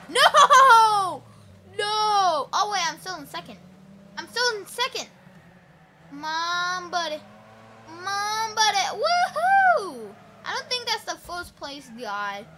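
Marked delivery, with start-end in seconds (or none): 0.1-2.5 much louder no with a laugh; real loud dismayed no; laughing shout of no. Real, not acted. Losing position in race. 8.9-10.1 much higher cheer; real excited woohoo; joyful whoop. Real, not acted. Celebrating in race.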